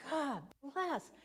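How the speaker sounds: high strained mock blessing through frustration; exasperated God bless. Driving her grandson, speaker says it in exasperation. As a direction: bless him while fuming. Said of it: "and I'm, like, going".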